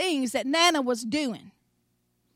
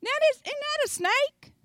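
put-on high, light little-boy voice, calmly curious; a small grandson calmly pointing at a snake; nonchalant little boy; 11 semitones higher than usual. Grandson asking his grandmother about the snake, high and unbothered. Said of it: "he's just kinda nonchalantly standing there going".

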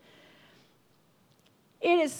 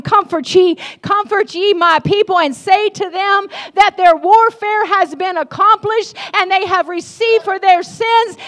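loud, high prophetic proclamation; prophesying; 6 semitones higher, 9 dB louder than usual. Acted. Pastor voices Isaiah prophesying comfort to God's people, loud proclamation. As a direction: declare it like a prophet on fire. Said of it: "he starts prophesying".

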